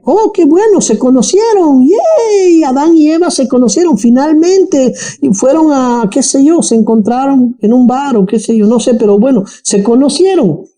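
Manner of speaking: high-pitched, hammed-up naive cheer; mock delight. Mock cheering over a naive reading.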